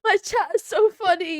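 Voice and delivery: can't stop laughing at chat. Real, not acted. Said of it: "I can't stop laughing".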